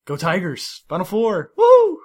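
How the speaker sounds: loud, rising sports cheer. Acted. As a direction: whoop it up for the team. Cheering for his old team.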